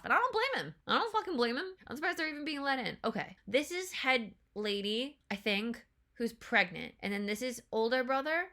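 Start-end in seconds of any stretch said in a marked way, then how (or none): 0.0-3.0 indignant. Real, not acted. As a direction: exclaim it with fired-up outrage. Indignant about the invaders.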